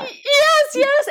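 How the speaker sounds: high, loud eager yes; excited yes. Real, not acted. Guest agreeing eagerly with the host, excitedly high and loud. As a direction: jump in with a delighted yes.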